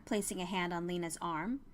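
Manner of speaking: plain reading voice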